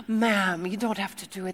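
soft, badly faked Congolese accent; mock Congolese accent. Tea lady gently refuses again, soft mock Congolese accent. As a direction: softly imitate her Congolese accent. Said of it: "her accent sounded nothing like this".

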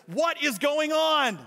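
high, slow, loud exasperated question; exasperated demand. Retold or imitated. Tourist demands answers from the translator, exasperated and high. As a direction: drag it out in disbelief. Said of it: "he looks at the translator and says".

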